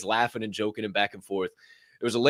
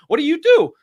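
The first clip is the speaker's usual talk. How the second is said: high curious stranger question; 9 dB louder than usual. Retold or imitated.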